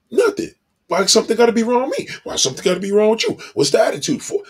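mock-touchy husband, rushed and high; defensive snapping. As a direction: fire back at the wife like she accused you. Husband snaps at his wife.

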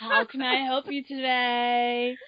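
polished office receptionist voice, high and loud; professional phone voice. Retold or imitated. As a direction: put on the customer service voice. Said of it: "and you're like".

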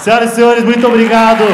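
loud announcer thanks; comic thanking the audience, loud showman sign-off. Real, not acted. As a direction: boom it out to close the set. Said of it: "closing the show".

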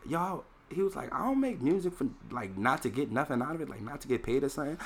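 a hint of [name]; quoting [name]. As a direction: relay his advice in a light mock voice. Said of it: "[name] was like".

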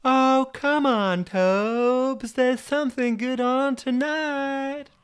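very high, slow, drawn-out coaxing voice; Jennifer. Acted. TV Jennifer keeping Toby from work, high coaxing voice. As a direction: coax him in a high put-on voice. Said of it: "she'd say".

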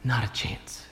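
whispered refusal; defiant whisper. Retold or imitated. Tiny Peter refusing the eternal King: defiant whisper. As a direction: breathe it out as a stubborn no.

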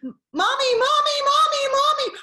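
high, loud cries for mommy; screaming child. Retold or imitated. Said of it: "they started screaming at me".

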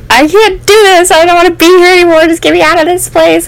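reliving a panicked, tearful meltdown; retold emotional breakdown. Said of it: "very emotional".

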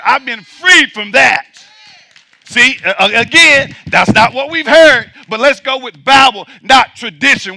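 loud, driving preacher's cadence; full-force preaching. Acted. Preacher driving home to the congregation that a dead man can't sin, loud and forceful. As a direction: hammer each line home from the pulpit.

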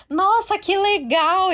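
mock-impressed father, high and slow; imitating dad's slow delight. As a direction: play the dad, pleasantly surprised and stretching it out. Dad reacting to pregnancy news.

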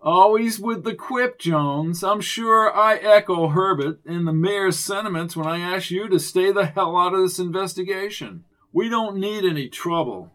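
loud, snooty official voice. A narrator's reading. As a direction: talk down to them, loudly. Officious Chance rebuking.

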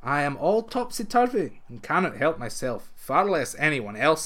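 ordinary delivery

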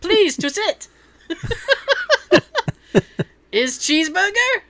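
lightly put-on Russian accent, broken English; hammed-up Russian waiter greeting, laughing; bad Russian accent. Waiter greeting American guests, mock Russian accent. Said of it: "I don't have a good Russian accent".

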